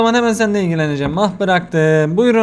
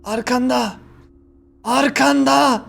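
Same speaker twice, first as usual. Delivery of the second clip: creepy ghost voice; 3 semitones higher, slower than usual. Acted. Gamer voicing the ghost taunting the cashier, eerie and slow. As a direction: drag it out like a haunting presence.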